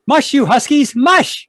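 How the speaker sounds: high, loud cry urging the team on; calling out. Acted. Driver urges his dog team.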